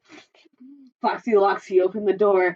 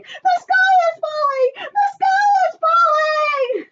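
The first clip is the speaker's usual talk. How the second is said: very high, loud squawking cry; squawking chick; 6 dB louder than usual. A narrator's reading. Chicken Little squawking the alarm all the way home. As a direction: squawk the warning like a panicked hen.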